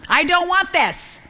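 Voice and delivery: high, drawn-out mock tantrum whine; hammed-up, stretched childish protest; temper tantrum. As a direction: whine it like a balking child, pitch way up. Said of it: "We do throw temper tantrums".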